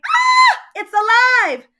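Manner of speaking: high, loud shriek of fright; terrified scream. A narrator's reading. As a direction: shriek like you've seen a monster. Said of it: "The lady screamed".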